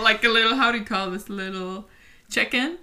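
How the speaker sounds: ordinary delivery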